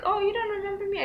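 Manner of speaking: high, fast, wounded question; mock hurt driver. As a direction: act offended that she forgot. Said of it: "He's like".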